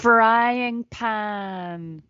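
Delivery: very slow, stretched-out delivery; drawn-out mock drama. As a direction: stretch every word for comic effect. Gleeful drawn-out narration of slapstick.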